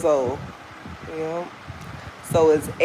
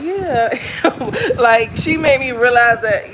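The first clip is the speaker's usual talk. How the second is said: higher, laughing through the words; laughing as you admit it; amused laughing; 5 semitones higher than usual. Real, not acted. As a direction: let a real chuckle break into the talk. Amused at being called American.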